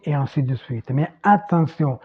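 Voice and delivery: ordinary talk, nothing marked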